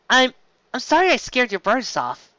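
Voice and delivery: lightly voiced awkward young mare; awkward Twilight. A narrator's reading. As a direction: stumble through the apology awkwardly. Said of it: "Twilight said awkwardly".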